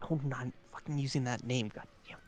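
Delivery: soft, fast grumble from afar; faint muttering. Acted. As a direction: mutter it under your breath, quickly. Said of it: "you faintly hear her say".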